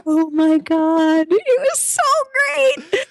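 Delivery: high, slow, breathless gush; starstruck gushing. Real, not acted. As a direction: swoon over meeting him. Gushing about meeting Steven Yeun.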